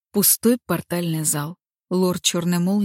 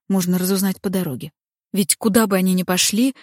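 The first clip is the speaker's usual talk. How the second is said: usual speaking voice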